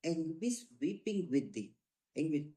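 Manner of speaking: drawn out word by word; slow rubric dictation. Shown as a demonstration. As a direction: read it out for students to copy. Teacher dictating a rubric name.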